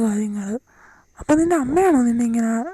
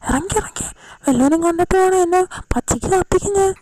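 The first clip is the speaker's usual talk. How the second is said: much higher, fast, frantic; panicked man; 5 semitones higher than usual. Acted. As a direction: shoo her out in a high panic. Panicked man shoos girl out. (പേടിച്ചരണ്ട, ഉയർന്ന സ്വരത്തിൽ)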